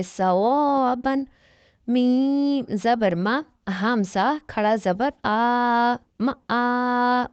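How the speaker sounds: slow sing-song spelling of each letter; slow syllable drill. Shown as a demonstration. Tajweed teacher drilling students syllable by syllable. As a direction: spell out each syllable slowly, like a Quran class.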